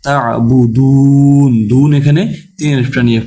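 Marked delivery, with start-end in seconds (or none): 0.1-1.5 "তা'বুদুন," slowly chanted, long final u; three-alif stretch. Shown as a demonstration. Teacher showing the stop, long-drawn "তা'বুদুন,". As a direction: hold the last vowel of "তা'বুদুন," before the n. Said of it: "তিন আলিফটা নিয়ে পড়তে হবে".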